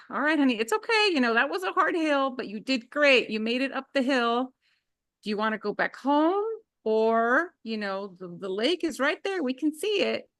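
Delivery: soothing mom. Retold or imitated. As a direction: lift her up gently after the fall.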